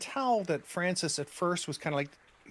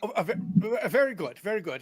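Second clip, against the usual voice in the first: stammered, hurried deference; slightly raised voice, eager to get away; lightly voiced servant backing down; flustered footman; 4 semitones higher, 5 dB louder, faster than usual. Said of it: "And he, he skitters off".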